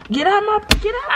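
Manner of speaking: annoyed shout. Real, not acted. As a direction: rattle it out fast and high to shoo them off. Pushing partner away.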